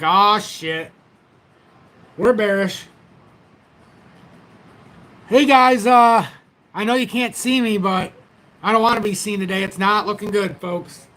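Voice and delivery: a hint of a hammed-up bad-news host; mock bear-market host. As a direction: pretend it's a grim day and greet them sadly. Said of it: "the only way you would know is I come on like this".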